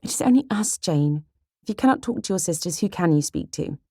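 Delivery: put-on high, coaxing girl's voice; pleading younger sister Kitty. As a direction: wheedle your sister into confiding.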